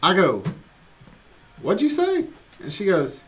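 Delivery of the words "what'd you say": What'd you say shot up high and sudden; sharp parent question. Retold or imitated. Dad catching daughter saying gosh, high startled challenge. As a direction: catch her out in disbelief.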